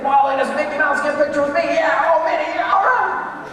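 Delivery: very high, loud, gushing delight; excited happy visitor. Pretend. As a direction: squeal like a thrilled tourist. Happy person meets Mickey.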